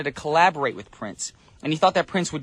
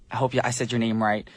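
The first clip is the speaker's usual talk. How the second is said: ordinary delivery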